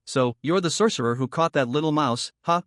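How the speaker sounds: ordinary delivery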